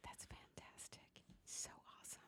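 excited whisper, barely voiced; a whispered so awesome. Real, not acted. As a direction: breathe it out in a hushed thrill.

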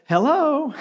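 high, sweet phone hello; sweetest tone. Retold or imitated. Woman answering phone after scolding kids, suddenly sweet. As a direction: pick up the phone all sugary. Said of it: "the sweetest tone of hello".

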